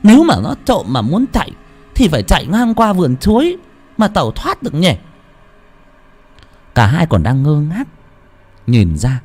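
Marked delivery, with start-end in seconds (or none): 0.0-5.0 high, quick puzzled muttering; puzzled maid voice. A narrator's reading. Tẹo wondering where the figure vanished, puzzled. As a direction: wonder aloud where the thief went.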